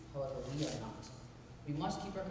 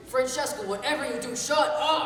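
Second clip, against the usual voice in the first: high, very loud command to shut up; desperate to silence her; furious shout; 9 semitones higher, 14 dB louder than usual. Acted.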